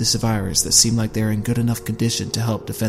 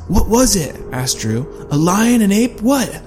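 higher, pressing questions; demanding; 9 semitones higher, slower than usual. A narrator's reading. Drew questioning Allie, pressing. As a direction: push for answers.

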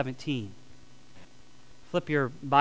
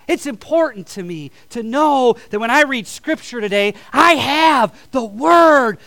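louder and higher, slowing to lean on the words of God; preaching at full force; 7 semitones higher, 9 dB louder than usual. Acted. Preacher to congregation about the words of God, full-force preaching.

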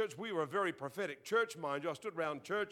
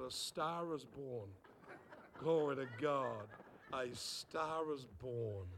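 low, slow and soft self-satisfaction; smug pride; 6 semitones lower, 6 dB quieter, slower than usual. Retold or imitated. Teen quietly congratulating himself after prophesying, smug. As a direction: savour it proudly. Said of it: "I was so proud of myself".

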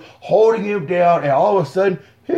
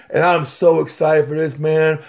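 usual speaking voice

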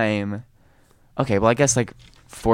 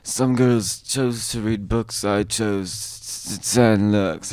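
a hint of a strange British-ish accent, slow; lightly put-on odd accent, drawn out; weird accent; 4 dB louder, much slower than usual. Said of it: "It was really weird accent".

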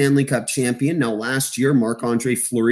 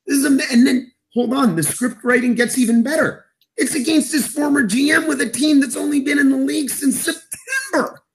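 very high, hammed-up disbelief; incredulous amazement; 12 semitones higher than usual. Analyst marvelling at the unlikely script, incredulous. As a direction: keep the pitch soaring in astonishment. Acted astonishment at unlikely final.

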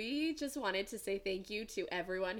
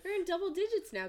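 excited; 12 semitones higher, 4 dB louder, faster than usual. Real, not acted. Celebrating episode ten.

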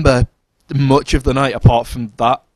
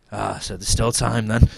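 suggestive woman; 2 semitones lower than usual. Retold or imitated. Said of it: "she was like".

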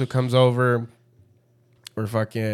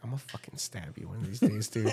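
muttered threat; 2 semitones lower, 9 dB quieter, faster than usual. Retold or imitated. Fed up with friend, muttered mock threat. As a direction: mutter it under your breath.

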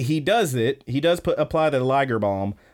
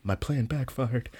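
muttered aside; 6 dB quieter than usual. Retold or imitated. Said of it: "Ida's like".

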